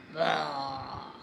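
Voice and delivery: low imitated tiger growl; tiger roar. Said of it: "the roar of a tiger".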